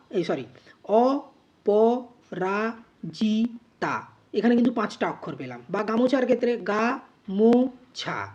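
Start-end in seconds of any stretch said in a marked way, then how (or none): 0.8-4.0 অপরাজিতা very slowly in five pieces, অ, প, রা, জি, তা; syllable by syllable. Shown as a demonstration. Teacher shows the other way for অপরাজিতা, syllable by syllable. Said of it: "যদি আমরা এরকম বলি". 6.6-8.3 গা, মো, ছা as three held, separate beats; separate syllables. Shown as a demonstration. Teacher shows words can be separated, গা, মো, ছা syllable by syllable. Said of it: "আলাদা আলাদাও করতে পারি".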